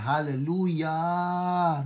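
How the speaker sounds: Hallelujah stretched slow with long held vowels; drawn-out praise. Acted. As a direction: read Hallelujah as one long cheer. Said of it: "Mahaba yun". (pinahabang Hallelujah)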